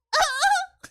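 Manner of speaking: short high squeal of laughter; high, loud laughing yelp; real laugh. Real, not acted. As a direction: let out a quick, high whoop of laughter. Cracking up mid-sentence.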